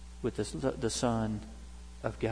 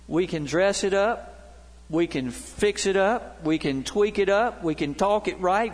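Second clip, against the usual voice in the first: rising, insistent sermon cadence, pressing each line; building preaching; 4 semitones higher than usual. Acted. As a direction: drive the list home to the congregation.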